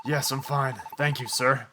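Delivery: put-on humble old dragon brushing it off; gentle dragon. Hydreigon reassuring the hero he is fine, lightly voiced and soft.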